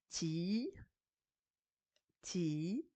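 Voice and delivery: "ti" with the i held long; sustained, drawn-out "ti"; recitation. Shown as a demonstration. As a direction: stretch each "ti" as a separate syllable. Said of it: "écoutez-moi, euh, ma récitation".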